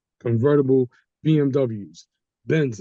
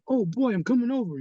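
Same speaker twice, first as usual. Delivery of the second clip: delighted; 10 semitones higher than usual. Retold or imitated. Excited about favorite food invitation.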